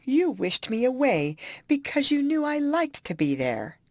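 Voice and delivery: a high, tearful accusation; weeping. A narrator's reading. High, wounded complaint through tears. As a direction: Isabella weeping, accusing Catherine of sending her away. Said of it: "wept the young lady".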